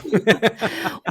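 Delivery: bright burst of laughter; amused laugh. Real, not acted. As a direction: laugh at the stiff intro. Laughing at the intro.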